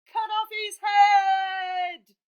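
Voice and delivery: very loud, high, slow shout; bloodthirsty crowd shout. Acted. Crowd shouts at George to behead the dragon, very loud. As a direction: roar for the kill.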